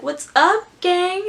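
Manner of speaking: perky high mock greeting; jokey Palpatine greeting. Jokey villain hologram greeting.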